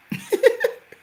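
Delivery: high burst of real laughter; bright, high laugh at her own story. Real, not acted. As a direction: let a delighted laugh break out.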